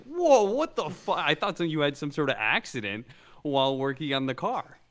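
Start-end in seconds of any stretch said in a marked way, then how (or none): 0.0-1.2 high, loud startled "Whoa, what the fuck?"; shocked. Retold or imitated. Cohost reacting to bloody hand photo, shocked high cry. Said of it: "I'm just like".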